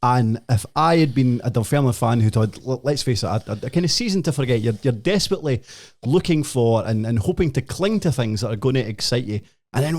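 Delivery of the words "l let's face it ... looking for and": ordinary talk, nothing marked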